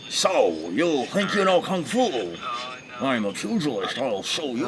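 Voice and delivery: hammed-up kung fu dub, swooping pitch; dubbed kung fu movie. Mock fighter challenging a rival, dubbed kung fu voice. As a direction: play a badly dubbed martial arts fighter.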